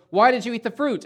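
God questioning Eve. Acted. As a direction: call out the question like a stern judge. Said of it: "he goes".

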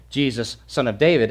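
usual speaking voice

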